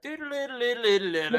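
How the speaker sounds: high sung ringtone jingle; ringtone imitation. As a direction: mimic a loud phone ringtone.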